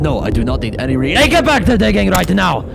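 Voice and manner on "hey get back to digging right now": loud, high command to get back to work; angry order. Acted. Captain ordering the crew back to work, loud angry order. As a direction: snap them back to digging.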